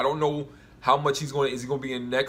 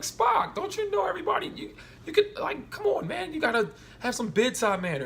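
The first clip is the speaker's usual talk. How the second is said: much higher, pleading voice telling him off; exasperated high scolding. Retold or imitated. Scolding Spock about his bedside manner, in a high exasperated plea. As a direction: whine at him to lighten up, come on man.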